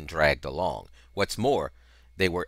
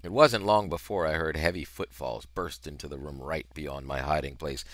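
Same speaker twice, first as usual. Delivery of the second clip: ordinary delivery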